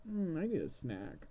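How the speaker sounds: a drawn-out mock craving; lightly acted hungry kid; hungry Hansel.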